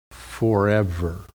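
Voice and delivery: forever slow and low, set apart; stressed forever. Shown as a demonstration. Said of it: "and notice this".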